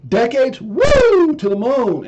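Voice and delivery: high whooping cheer; excited. Real, not acted. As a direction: whoop it up with hype. Hyped crypto cheer.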